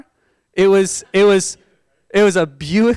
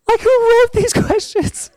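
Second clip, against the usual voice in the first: very high, laughing squeal of disbelief; comic exasperation; incredulous complaint; 4 dB louder than usual. Retold or imitated. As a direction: protest in a squeaky voice, breaking into laughter. Mock outrage at test questions.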